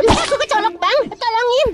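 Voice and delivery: high whiny puppet voice; squeaky creature whining. Acted. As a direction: whimper like a poked little toy. Pot mengadu kesakitan. (suara cicit merengek tinggi)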